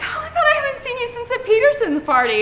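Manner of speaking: high, gushy reunion chatter; hammed-up delight; faked old-friend gushing. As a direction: gush at a stranger as if old friends. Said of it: "pretend that I know them".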